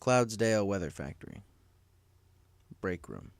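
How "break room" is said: ordinary delivery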